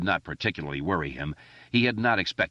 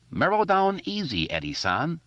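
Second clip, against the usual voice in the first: high, hammed-up mock Chinese accent; a kid clowning in a put-on Chinese accent, pitched up high; teasing a jumpy friend in a high mock Chinese accent; 11 semitones higher than usual. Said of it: "doing his Chinese coolie voice".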